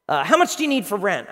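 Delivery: mock brusque questioning; gruff boss. Boss moves on to employee's rent, mildly gruff. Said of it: "he's just gruff".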